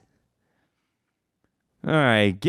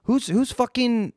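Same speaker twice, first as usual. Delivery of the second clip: mock outrage; 10 semitones higher than usual. Repeated outraged question.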